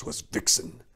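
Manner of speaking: ordinary delivery, nothing marked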